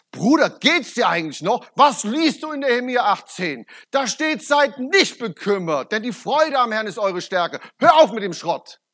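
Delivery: loud, indignant retold rebuke; angry rebuke. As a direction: tell him off, fired up like Jesus in the temple. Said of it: "ich war so richtig zornig". (lauter, empörter Tadel)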